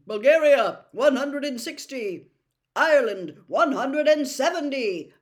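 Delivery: big public announcement; stadium score announcement. Acted.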